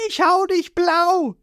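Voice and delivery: high, slow cartoon threat; roaring boy hero. Ruffy threatening the giant whale, high cartoon roar. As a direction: bellow it like a brash kid. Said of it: "Ruffy brüllt".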